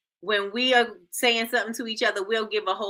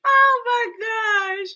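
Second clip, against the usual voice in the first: slow, high exclamation full of laughter; laughing; 10 semitones higher, 3 dB louder, much slower than usual. Real, not acted. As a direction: gasp it out mid-laugh. Laughing hard.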